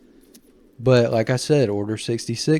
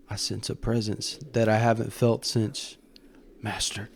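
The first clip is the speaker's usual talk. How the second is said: trembling voice; 6 dB quieter than usual. Retold or imitated. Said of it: "you can hear his voice tremble".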